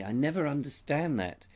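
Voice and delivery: ordinary talk, nothing marked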